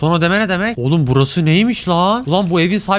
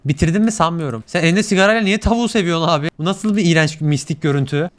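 usual speaking voice

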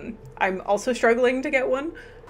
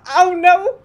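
mock alarm; 8 semitones higher, 10 dB louder than usual. Viewer jokes about her address.

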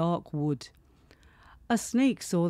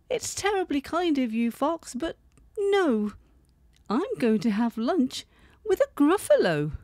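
high, bright squeaky little mouse; 7 semitones higher than usual. Acted. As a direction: clever mouse politely refusing, high pitched. Mouse voiced in story.

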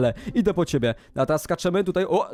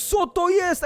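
high, gasped-out question; shocked exclamation; 13 semitones higher, slower than usual. Real, not acted. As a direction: cry out at a nasty discovery. Sees zombies below.

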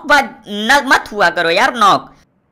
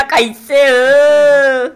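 very slow, higher-pitched stretched greeting; put-on voice; 6 semitones higher, 5 dB louder, much slower than usual. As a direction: disguise your voice so the friend won't know you. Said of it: "मेरी आवाज पहचान लो ना?".

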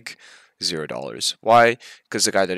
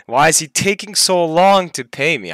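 exasperated laughing; 6 semitones higher, 4 dB louder than usual. Real, not acted. Laughs at unpaid money.

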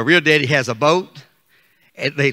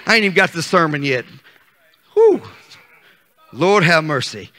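usual speaking voice

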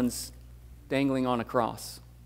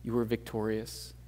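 soft, hushed prayer voice; quiet prayer; 4 semitones lower, 9 dB quieter than usual. Acted. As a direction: pray softly to God. Closing prayer before the sermon.

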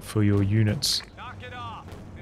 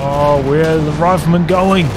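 rising excitement, louder and higher; excited; 4 semitones higher, 6 dB louder than usual. Real, not acted. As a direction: caught up in the action. Caster reacts to sudden play.

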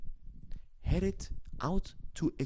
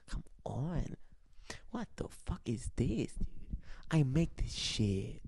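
usual speaking voice

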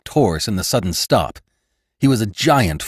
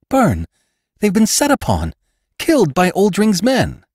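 high-pitched distressed outcry; horrified alarm; 12 semitones higher than usual. Acted. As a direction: cry the terrible thought aloud, voice high. Jane fears her riders are killed.

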